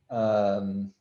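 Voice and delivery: usual speaking voice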